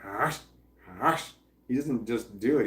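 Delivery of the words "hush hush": imitated snap of a dog; short, sharp mock dog snaps; dog snapping noise. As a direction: do a quick huffing dog snap. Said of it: "that makes a noise like".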